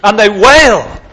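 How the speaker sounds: loud high wail; demonstrated wail. Shown as a demonstration. Preacher acting out mourners at the house, wailing. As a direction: let the wail rise and fall. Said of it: "They beat the chests like this".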